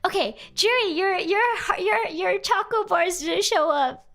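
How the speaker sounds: playful put-on voice. Reading chat in put-on voice.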